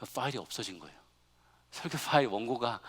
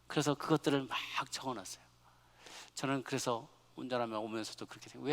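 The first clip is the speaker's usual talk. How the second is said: normal talking voice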